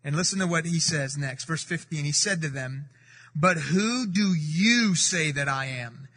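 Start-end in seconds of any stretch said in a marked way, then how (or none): 3.4-6.1 slow, with "you" pressed hard and long; emphatic you. Acted. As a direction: lean heavily on "you", slowly. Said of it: "that you is an emphatic you".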